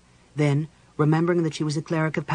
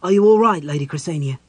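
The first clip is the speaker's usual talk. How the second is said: louder, calling out in alarm; loud worried call; 3 semitones higher, 9 dB louder than usual. Acted.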